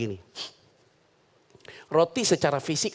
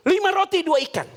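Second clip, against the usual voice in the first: eager blurt; 13 semitones higher than usual. Acted. Disciples blurting to Jesus, higher and eager.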